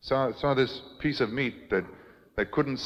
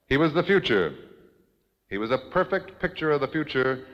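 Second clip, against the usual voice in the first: usual speaking voice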